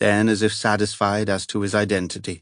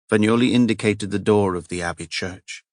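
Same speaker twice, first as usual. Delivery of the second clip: ordinary talk, nothing marked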